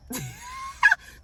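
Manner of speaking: cracking up at the memory; high burst of laughter. Real, not acted. Storyteller laughing at her boyfriend's stunned face, squealing laugh.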